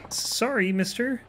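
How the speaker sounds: put-on high-pitched little kid apology; apologetic child. Child apologizing to angry samurai.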